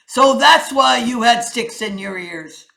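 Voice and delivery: loud, ringing king's proclamation; lion king. Acted. King addresses the council, loud and commanding. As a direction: announce the chain of blame.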